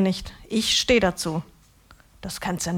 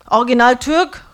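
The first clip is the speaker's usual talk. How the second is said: louder, higher call through the door; raised call reading the sign aloud; 4 semitones higher, 10 dB louder than usual. Acted. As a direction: shout it through the open door. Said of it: "ruf ich durch die geöffnete Tür".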